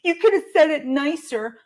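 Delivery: high, loud mock-offended whine; mock hurt complainer. Vlogger mimicking someone who got their feelings hurt, mock-wounded. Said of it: "If you're like".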